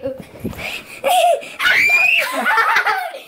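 high shrieking laugh. Real, not acted. Laughing after slipping.

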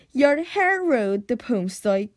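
ordinary delivery

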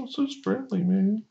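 high-pitched put-on dopey excuse; mocking their excuse. T T S A excusing backhanded compliments, high mocking voice. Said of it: "that's when they say".

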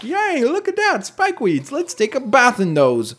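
high, slow, naive cheer; self-mocking gullible excitement; mock silly glee. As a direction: cheer slowly like a clueless innocent. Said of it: "I was so silly".

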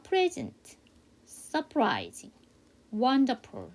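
"Present, surprising, wonderful" as separate practice words; teacher leading a word drill, pausing after each; repeat-after-me model. Shown as a demonstration. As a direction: model each English word slowly, "surprising" well stretched. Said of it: "저랑 같이 한번 연습해봐요".

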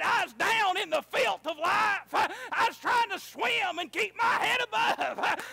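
high, loud, laughing testimony; laughing shout. Retold or imitated. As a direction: shout it with joy breaking through.

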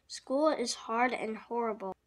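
usual speaking voice

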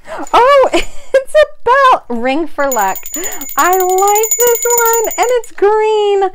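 high, loud squeal of delight; delighted excitement. Real, not acted. Opening Donna's gift of a bell, delighted excitement. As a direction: gush over it in a high, thrilled voice.

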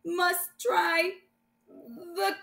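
slow, high, woozy; woozy, fading. Acted. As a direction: struggle to stay awake. Poison gas, fading.

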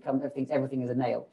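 usual speaking voice

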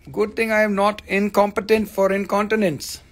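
ordinary talk

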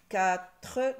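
"Quatre" in two loud beats; loud, split sounding-out of "Quatre"; syllable by syllable. Shown as a demonstration. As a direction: separate "Qua" and a full "tre". Teacher trying out syllables.

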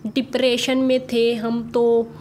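slow, measured imitation of their way of talking; imitated slow speech. Vlogger retells their video statement in their slow manner, slow imitation. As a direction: mimic their slow, halting delivery, phrase by phrase. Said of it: "इस वजह से मैं ऐसे slow slow बोल रही थी".

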